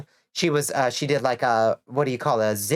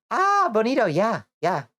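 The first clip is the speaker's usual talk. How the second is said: excited; 8 semitones higher than usual. Real, not acted. Delighted at a fellow Georgian.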